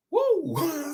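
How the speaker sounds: high excited "Woo!"; excited whoop. Real, not acted. Preacher cheering her own point, a high whoop.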